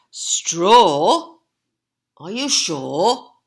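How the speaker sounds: loud surprised "Straw?"; doubtful asking. Acted. Percy doubting Horace, loud surprised question. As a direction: question his brother's choice. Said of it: "asked Percy".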